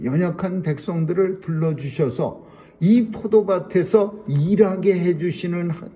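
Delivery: usual speaking voice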